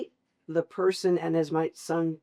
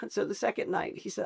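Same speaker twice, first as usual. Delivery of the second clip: usual speaking voice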